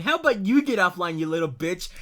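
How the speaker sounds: ordinary talk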